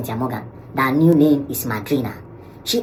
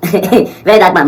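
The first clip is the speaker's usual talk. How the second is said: laughing repeat; 7 semitones higher, 11 dB louder, much slower than usual. Real, not acted.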